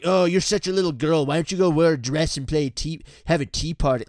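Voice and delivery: loud mock jeer; taunting gamer bully. Gamer mocking a high-voiced kid on voice chat, loud taunting. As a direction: imitate a bully belittling a kid.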